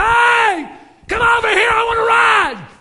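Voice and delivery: put-on high-pitched hollering like an eager child; excited little boy. Little boy calling the paddle boat over to the bank, excited shout. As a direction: shout it out at the very top of the voice. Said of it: "that little boy got all excited".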